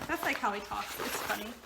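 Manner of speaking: ordinary talk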